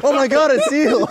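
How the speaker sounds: high, loud gushing cry with a laugh; mock excitement. Mocking beachgoers running to the dead seal, gushing. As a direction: gush like an excited tourist.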